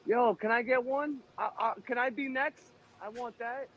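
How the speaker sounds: high-pitched pleading for a turn; eager begging. Pretend. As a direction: beg like a kid wanting the next turn.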